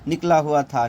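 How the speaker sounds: ordinary talk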